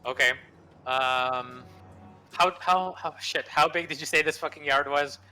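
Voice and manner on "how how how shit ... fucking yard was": exasperated. Real, not acted. Player annoyed at distance.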